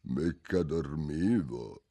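low, slow, sleepy rasp; tiny husky voice of a drowsy dormouse; small hoarse voice. A narrator's reading. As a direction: croak it out half asleep. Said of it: "con una vocina roca roca".

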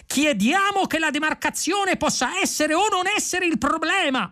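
very high, strained cry; excitable indignant protester; yelled demand. A narrator's reading. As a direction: proclaim it on a sustained high pitch. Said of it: "urlò Vrumfondel".

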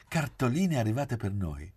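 plain reading voice

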